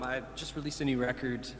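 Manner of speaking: ordinary delivery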